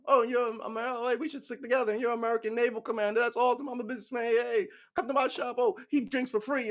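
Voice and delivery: high, fast-talking flattery; butter up the commander in a high, eager voice; hammed-up chummy salesman; gushing businessman. Plays businessman befriending naval commander.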